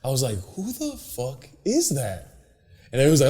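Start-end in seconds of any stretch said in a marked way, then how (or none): 0.5-2.4 slow, soft, higher amazed question; stunned question. Retold or imitated. First seeing Brody onstage, slow hushed amazement. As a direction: drag it out in disbelief. Retelling his amazed reaction.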